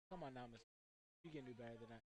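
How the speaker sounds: low, soft, disappointed chiding; gentle chiding. Real, not acted. Commentator scolding a player for kicking out, low and soft. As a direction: tut at the player, let down.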